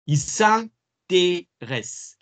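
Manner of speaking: "Il s'intéresse" drawn out very slowly and high; very slow model French. Shown as a demonstration. Teacher dragging out "Il s'intéresse" for students. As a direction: stretch "Il s'intéresse" right out, syllable by syllable. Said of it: "teacher models French slowly".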